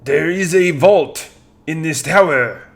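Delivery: put-on slow French-accented reveal; french chef. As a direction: announce it slowly in a French accent. Candelabra reveals vault.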